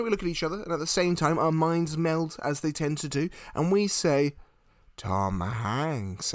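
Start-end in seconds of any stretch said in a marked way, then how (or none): 5.0-6.3 low, drawn-out, portentous name; mock-solemn reveal. Two friends answering aliens as one, mock-solemn. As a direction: intone it like a grand answer.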